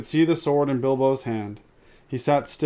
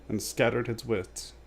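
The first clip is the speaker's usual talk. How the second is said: ordinary delivery, nothing marked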